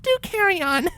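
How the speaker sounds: ordinary delivery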